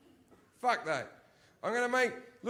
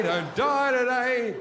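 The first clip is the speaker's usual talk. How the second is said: louder plea; 5 semitones higher, 6 dB louder, slower than usual. Retold or imitated. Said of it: "what dreams are like in Africa".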